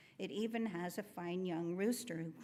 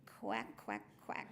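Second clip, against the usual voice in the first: low, throaty duck quacks; duck quacking; 9 semitones lower, 4 dB quieter, slower than usual. A narrator's reading. Duck shows the rooster how to crow, low quacking. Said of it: "tilted her bill up and crowed".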